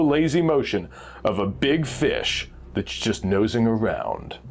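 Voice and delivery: ordinary delivery, nothing marked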